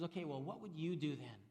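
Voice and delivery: usual speaking voice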